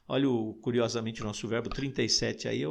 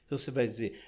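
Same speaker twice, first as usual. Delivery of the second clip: ordinary talk, nothing marked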